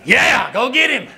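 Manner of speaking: high, eager cheer from the sidelines; cheering him on. Retold or imitated. Preacher cheering his friend into the ring, eager. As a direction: egg him on like a fan at ringside. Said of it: "I was like".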